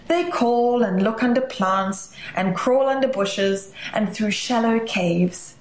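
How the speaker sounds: usual speaking voice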